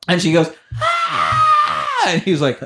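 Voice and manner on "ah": high-pitched mock scream; imitated kid's scream. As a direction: let out a child's shriek in imitation. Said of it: "and then the scream".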